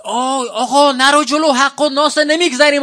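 shouting. Retold or imitated. Caravan manager yelling at pilgrims not to push ahead, loud. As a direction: yell at the pilgrims to stop pushing ahead. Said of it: "این داد زد".

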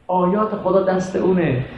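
normal talking voice

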